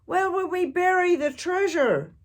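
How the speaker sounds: loud, high desperate question; hollering. A narrator's reading. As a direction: wail it to the crew. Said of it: "hollered Braidbeard".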